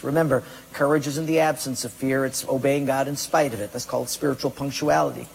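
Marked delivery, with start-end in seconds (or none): none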